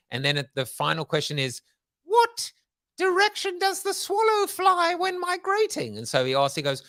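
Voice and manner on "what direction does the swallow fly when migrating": very high, slow sketch-comedy bridge keeper; hammed-up Monty Python gatekeeper; terrible bridge keeper accent. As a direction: draw it out in a high, silly put-on voice. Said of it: "in my terrible accent".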